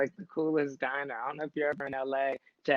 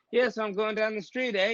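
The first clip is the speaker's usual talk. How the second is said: higher-pitched mock Canadian habit; imitating Canadian "eh"; 7 semitones higher than usual. Guest mimics a friend who picked up "eh", lightly imitated Canadian. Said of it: "guy that was from Canada".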